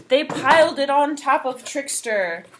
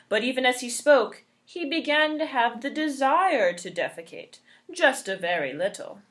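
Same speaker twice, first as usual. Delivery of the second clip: plain reading voice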